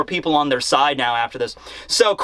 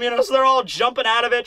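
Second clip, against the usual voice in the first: usual speaking voice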